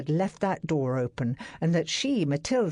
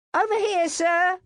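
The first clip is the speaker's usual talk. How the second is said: much higher, louder footman's shout; 4 dB louder than usual. A narrator's reading. Footman calls his master to the find, shouting. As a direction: shout to the master across the garden. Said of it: "a footman shouted".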